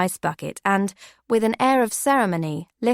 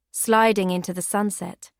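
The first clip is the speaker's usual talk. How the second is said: ordinary delivery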